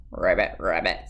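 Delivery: low croaking ribbit; frog croak. A narrator's reading. Witch turned frog, croaking low. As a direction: drop deep and croak like a frog. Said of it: "said the frog".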